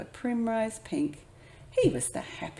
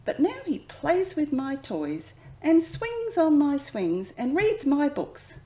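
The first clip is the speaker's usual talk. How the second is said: put-on child's complaint; 6 semitones higher, 6 dB louder than usual. A narrator's reading. Child complaining about little brother.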